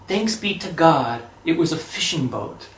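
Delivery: usual speaking voice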